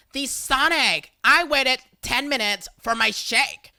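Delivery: mock outrage about a late shake; indignant huffing. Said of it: "huffing and puffing in either indignation".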